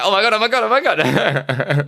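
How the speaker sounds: high, breakneck panicked gabble; mock panic. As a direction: babble it like you're losing control, half laughing. Mimics panic while drumming.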